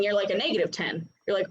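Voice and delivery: usual speaking voice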